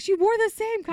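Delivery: loud, high indignant protest; mock mad outburst. Friend retelling a costume contest loss, mock mad outburst. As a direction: blurt it out in hammed-up outrage. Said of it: "I was so mad".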